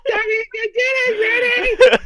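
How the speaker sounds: high, slow, laughing mock call; calling kids to dinner. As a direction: imitate a mum yelling up the stairs. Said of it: "my mum calling me for dinner".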